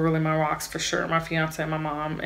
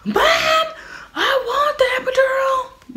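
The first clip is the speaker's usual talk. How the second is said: very high, loud wailing scream; reenacted scream; 4 dB louder, slower than usual. Laboring woman begging her mom for the epidural, screaming. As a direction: scream out a labor plea, re-enacted. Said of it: "I was screaming like".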